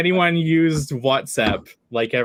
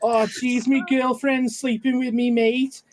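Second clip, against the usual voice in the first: Scouse accent; 9 semitones higher than usual. Shown as a demonstration. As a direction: whine through a put-on Liverpool accent. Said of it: "can you do a Scouse accent".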